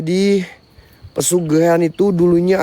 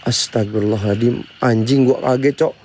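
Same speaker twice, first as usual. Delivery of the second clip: usual speaking voice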